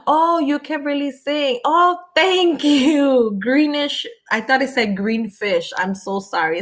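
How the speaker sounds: usual speaking voice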